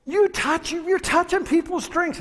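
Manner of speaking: very high, outraged imitation; mock ranting. Angry customer accusing the man of touching drinks, mock ranting. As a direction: screech the accusation, hammed up. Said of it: "he's ranting and he's raving".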